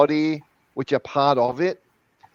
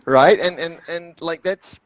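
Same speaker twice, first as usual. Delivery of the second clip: ordinary delivery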